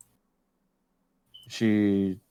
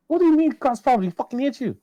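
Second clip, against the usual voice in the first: high-pitched, incredulous acted protest; retold indignant outburst; 3 dB louder than usual. Arguing with girlfriend who won't report ex, indignant. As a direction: replay the argument, voice shooting way up.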